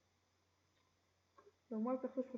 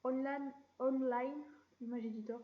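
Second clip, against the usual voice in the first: put-on English accent, a touch playful; French speaker showing off his English accent; 3 dB louder than usual. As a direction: read the English words with a hammed-up English accent. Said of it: "Vous connaissez mon accent anglais".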